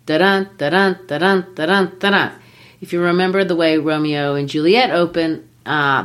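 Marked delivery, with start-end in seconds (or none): 0.0-2.3 da-DUN, second syllable stressed each time; heartbeat rhythm. Shown as a demonstration. Teacher imitates the human heart, stressed DUN in "da-dun". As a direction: beat out "da-dun" like a heart, weak DA then strong DUN. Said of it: "it replicates the human heart".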